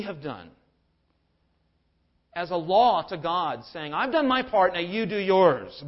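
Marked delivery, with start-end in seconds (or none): 3.9-5.8 much higher, louder demanding; bargaining with God. Retold or imitated. A believer bargaining with God, higher and louder. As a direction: insist God owes you, building emphasis. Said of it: "bargaining with God".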